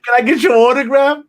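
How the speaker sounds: a fan, mimicked high and loud, begging for an autograph; imitating a fan. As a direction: ham up a starstruck fan asking. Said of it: "people be like".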